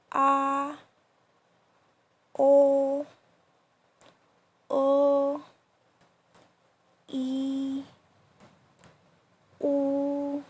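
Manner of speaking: A, o, e, u, u held high and perfectly level; A, o, e, u, u as long steady high-pitched syllables; first tone, high and flat. Shown as a demonstration. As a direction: draw out each vowel A, o, e, u, u on one high flat note. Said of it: "very high pitch and flat".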